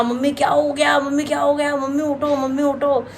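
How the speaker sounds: a mild mock of crying for mom to wake. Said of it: "मेरा एकदम से ऐसे रोना".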